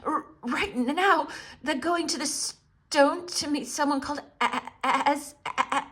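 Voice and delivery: high, stuttering frightened voice; chattering stammer. A narrator's reading. Edmund telling the witch of Aslan, stammering. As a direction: stammer from cold and fear. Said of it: "through chattering teeth".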